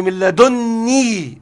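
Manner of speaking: "لدني" with a held, doubled nun. Shown as a demonstration. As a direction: stress the nun in "لدني", drawing it out as ladunnī. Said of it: "بالتشديد على النون".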